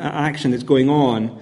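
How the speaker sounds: usual speaking voice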